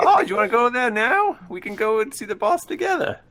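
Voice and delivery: high-pitched eager character voice; Jimmy. Acted. Jimmy offers to come along to the boss, high voice.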